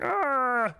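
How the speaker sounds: sudden high yelp of fright; startled yelp. Retold or imitated. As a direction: yelp like something just grabbed you. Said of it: "That was the voice I did".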